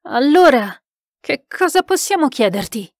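overexcited cry. A narrator's reading. Sitter eagerly questions the spirit, overexcited. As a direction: call out with keen excitement. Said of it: "gridò Moir, che era sovraeccitato".

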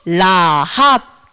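라합 said slowly, syllable by syllable, low; slow model pronunciation. Shown as a demonstration. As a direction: stretch out 라합 for children to repeat. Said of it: "따라해볼까요?". (라합을 천천히 또박또박)

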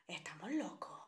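conspiratorial stage whisper. Acted. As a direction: whisper it, no voice at all. Repeats her line as a whisper.

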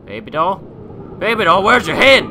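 high, loud questioning yell; alarmed calling out. Real, not acted. Player to a headless baby doll, alarmed yell. Startled gamer calls to the doll.

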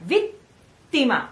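Vittima, tongue held on the T then released; held double T. Shown as a demonstration. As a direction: hold the T in Vittima a beat. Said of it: "and you stay there".

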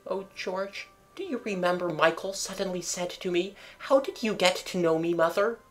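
tender mother. A narrator's reading. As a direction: remind George tenderly. Mother recalling to husband.

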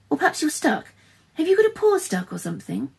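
put-on high, worried young heroine; fretful, high-pitched concern; anxious questioning. A narrator's reading. Girl anxiously questions stuck cub.

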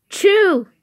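loud high fake sneeze; big sneeze. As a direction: blast out the sneeze. Sneeze ends the build.

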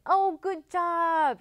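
slow, loud, high praise; excited praise. Real, not acted. Praising children after rhyme.

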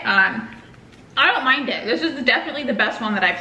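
talking while chewing; chewy muffled words; mouth full of mango. Real, not acted. Seen 0: She bites mango at -1.0, then talks while her jaw works.

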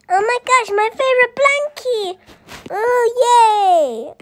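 high, thrilled squeal over a blankie; excited child. Pretend. Kid thrilled to get her favorite blankie, squealing.

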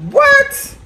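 very high squeal of surprise; a piercing, disbelieving what; shocked what. Retold or imitated. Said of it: "I said".

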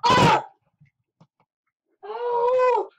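loud high shocked cries; shocked exclamation. Real, not acted. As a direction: yelp at the sour taste. Tasting sour candy.

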